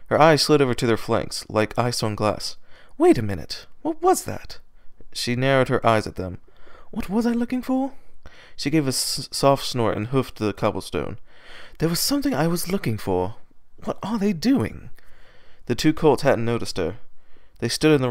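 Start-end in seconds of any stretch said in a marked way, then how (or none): 3.0-4.6 much higher, puzzled inner voice; a sudden bewildered squeak of thought; high Rarity thought. Acted. Rarity wondering about the cutie marks, high puzzled voice. 6.9-14.9 much higher, puzzled inner voice; a sudden bewildered squeak of thought; high Rarity thought. Acted. Rarity wondering about the cutie marks, high puzzled voice.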